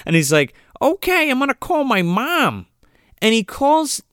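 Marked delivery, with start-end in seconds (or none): 0.8-2.7 high-pitched imitation of Kevin; mock kid, pitched well up; voiced movie kid. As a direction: put on a high little kid's voice. Retelling young Kevin's reply.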